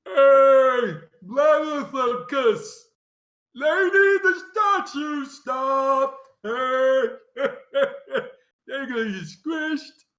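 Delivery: high, very loud, drawn-out ogre bellow; hollering ogre. Acted. Game master as ogre calling to the castle for help, hollering. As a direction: holler it toward a far-off castle, stretching the words. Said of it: "turns and hollers back towards the massive castle".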